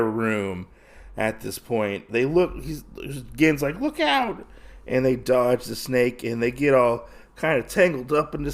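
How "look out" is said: high-pitched mock warning cry; urgent warning. Gin warning Usagi of the lunging snake, high urgent cry.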